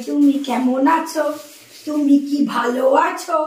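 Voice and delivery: slow, drawn-out questions; slow, put-on sing-out; put-on questioning. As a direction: stretch each word out in mock concern. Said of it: "তাই বলতে হবে".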